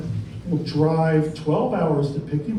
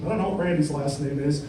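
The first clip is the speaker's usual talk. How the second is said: ordinary talk, nothing marked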